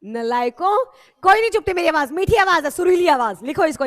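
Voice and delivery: loud, playful indignation; loud mock scolding. Real, not acted. Teacher defending her sweet voice to students, loud mock scolding. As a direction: scold them loudly in jest.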